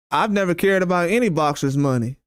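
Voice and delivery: usual speaking voice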